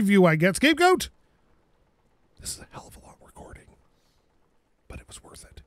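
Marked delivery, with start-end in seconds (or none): 2.4-5.6 soft breathy whisper to close. Real, not acted. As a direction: whisper the last words to viewers. Whispered sign-off.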